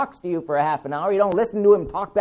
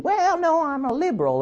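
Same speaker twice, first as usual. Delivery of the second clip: mock caller reply; 6 semitones higher than usual. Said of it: "or something she said".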